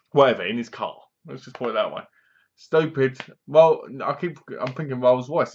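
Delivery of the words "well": ordinary talk, nothing marked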